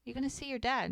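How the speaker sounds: lightly acted feigned casualness; tentative probing. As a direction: imitate someone feeling out a touchy subject. Retold partner casually probing about dad.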